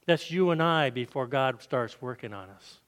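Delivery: ordinary delivery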